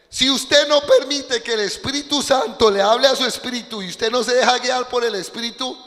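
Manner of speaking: fervent preaching; full-force preaching. Acted. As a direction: drive it home to the congregation.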